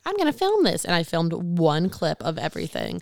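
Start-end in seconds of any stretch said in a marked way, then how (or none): 0.0-0.8 mock eager thought. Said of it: "because I'm like".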